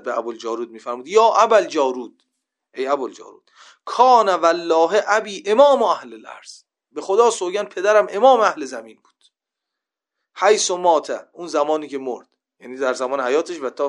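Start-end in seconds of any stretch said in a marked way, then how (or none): 1.1-11.3 imitating the Imam's shout, loud and higher; raised voice. Imam calling out to Abu al-Jarud in the Mina tents, raised voice. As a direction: call it out forcefully, as the Imam rebuking a follower.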